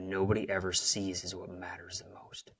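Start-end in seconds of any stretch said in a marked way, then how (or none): 1.2-2.5 whisper. Real, not acted. Key lesson stated.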